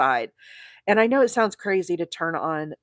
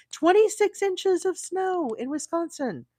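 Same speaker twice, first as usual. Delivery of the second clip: amazed; 11 semitones higher than usual. Real, not acted. Marvelling at a friend's snowfall.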